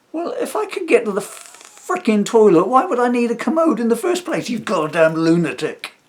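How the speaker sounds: loud, high furious outburst; exasperated rant. Retold or imitated. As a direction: explode at an absurd suggestion, dragging out the insult. Ranting at the absurd suggestion.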